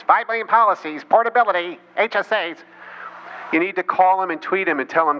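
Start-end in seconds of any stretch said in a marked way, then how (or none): 0.0-2.6 mocking Hannity. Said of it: "Hannity repeats his talking points".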